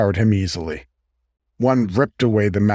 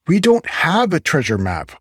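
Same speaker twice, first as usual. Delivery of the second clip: mild pant; 7 semitones higher than usual. Acted. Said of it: "Oakley panted".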